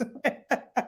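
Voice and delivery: short chuckle; laugh. Real, not acted. Coach teases parents as superstars, laughing. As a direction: laugh at your own jibe. Laughs at own sarcastic remark.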